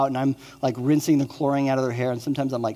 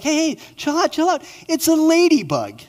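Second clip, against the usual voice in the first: higher and louder, urgent calling; 14 semitones higher, 6 dB louder than usual. Retold or imitated. Father calling to his screaming daughter over a ladybug, high and urgent. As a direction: call out over her panic to calm her.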